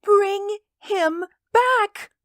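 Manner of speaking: very high, drawn-out desperate cry. A narrator's reading. Old woman begging for her son, crying out. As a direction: stretch each word in a high plea. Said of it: "cried the old woman".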